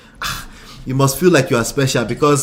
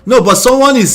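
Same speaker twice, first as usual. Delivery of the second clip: emphatic insistence; 8 semitones higher, 6 dB louder than usual. Real, not acted. Insisting against the friend's denial.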